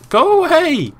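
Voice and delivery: high, loud yell at an attacker; real high-pitched yell; exasperated shout. Real, not acted. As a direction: shout them away, voice shooting up.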